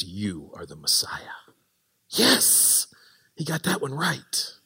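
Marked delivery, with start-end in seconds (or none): 0.0-1.2 low whispered realization; hushed whisper. Retold or imitated. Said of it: "Peter says". 2.1-2.9 higher breathy cheer; excited yes. Real, not acted. Cheering Peter's right answer.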